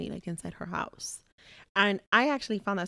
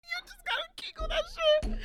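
laughing. Real, not acted. Laughing hard.

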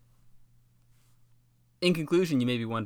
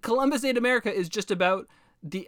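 ordinary talk, nothing marked

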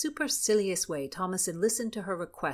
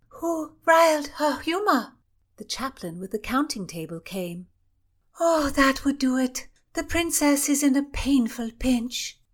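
lightly voiced court lady; 6 semitones higher, 6 dB louder than usual. Lady-in-waiting gossip.

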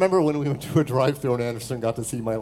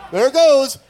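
high, loud excited cry; imitating excited guy; 9 semitones higher, 7 dB louder than usual. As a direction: cheer it out like an excited kid.

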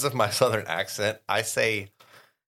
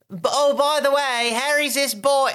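much higher, louder sing-song mock; mocking recap; 14 semitones higher, 5 dB louder than usual. Co-host mocking a lazy recap opening. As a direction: parody a clumsy recap. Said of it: "We didn't get this, like".

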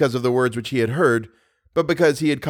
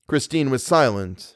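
usual speaking voice

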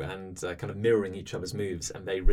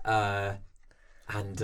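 normal talking voice

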